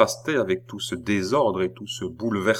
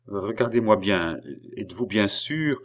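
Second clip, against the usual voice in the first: plain reading voice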